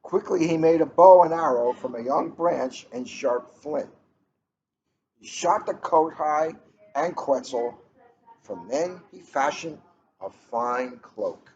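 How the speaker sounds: gentle, even read-aloud pace; storybook read-aloud. A narrator's reading. Librarian reading a picture book to the room, storytelling voice.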